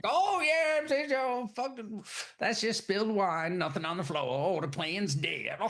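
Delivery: very high, squeaky voice for a fat sloppy boss; a hammed-up shifty old boss brushing off the blood; boss hog character. Retelling the movie, imitating the sloppy boss hog owner, squeaky and high. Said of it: "boss hog looking character's like".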